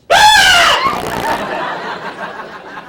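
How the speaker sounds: mock terrified shriek. Mock scream on plane.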